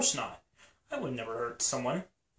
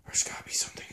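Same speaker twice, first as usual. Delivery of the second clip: whisper; 5 dB quieter, much faster than usual. Acted. Sneaking through a dark room.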